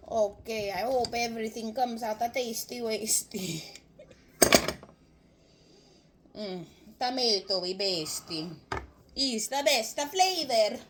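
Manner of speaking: sing-song put-on Italian accent. Cook hoping the soup turns out tasty, in an Italian accent. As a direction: play with the words as an Italian cook. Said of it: "Forgive my Italian accent".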